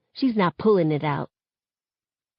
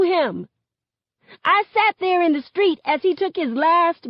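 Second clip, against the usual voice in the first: high, straining, grief-stricken protest; anguished outburst; 10 semitones higher than usual. A narrator's reading. Daughter telling mother she watched her friend die, anguished. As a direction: pour out the hurt, voice pitched high.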